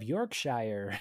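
"Yorkshire" ending in a full "shy-er"; mock American "Yorkshire", both parts spelt out; American mispronunciation. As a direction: say "Yorkshire" as "York-shire", rhyming with fire. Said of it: "they say "shire," like Shire".

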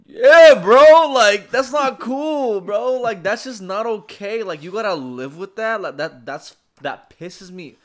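re-enacted upset yelling, high and very loud; loud high wail of outrage, retold; pissed-off crying outburst. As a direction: act out angry, worked-up protest, easing off toward the end. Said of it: "I was that fucking pissed off. I'm just fucking crying".

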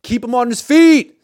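loud, slow shouted order; coach yelling. Retold or imitated. Reporter imitating coaches chewing out a player. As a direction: yell it at the player who knocked someone down. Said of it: "he's getting chewed out immediately".

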